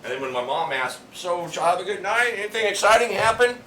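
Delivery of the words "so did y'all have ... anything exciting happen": high, lilting mock-mom question; imitated curious mom. Mom asks about their night out, imitated high curious voice. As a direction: lightly play mom fishing for news, pitched up.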